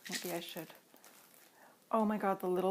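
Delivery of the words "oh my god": ordinary talk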